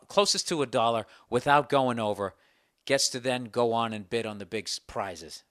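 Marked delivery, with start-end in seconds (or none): none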